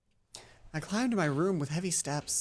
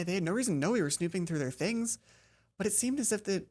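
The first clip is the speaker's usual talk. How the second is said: ordinary talk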